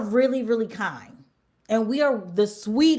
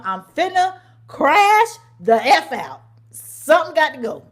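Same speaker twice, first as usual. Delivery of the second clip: fed-up outburst; 4 semitones higher, 7 dB louder than usual. Retold or imitated. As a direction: tell the boss you're about to snap. Venting to regional manager, fed up.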